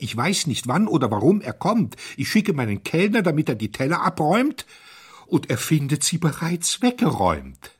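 high, worked-up, agitated account; distressed. A narrator's reading. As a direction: pour it out in anguished protest. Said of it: "rang gequält die Hände". (gequält und aufgeregt)

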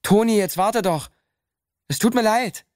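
high, loud desperate shout after him; desperate calling. Acted. Mike calls desperately after the fleeing boy. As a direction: call after a friend running away. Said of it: "die Verzweiflung in Mikes Stimme".

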